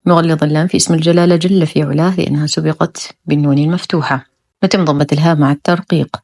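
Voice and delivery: ordinary delivery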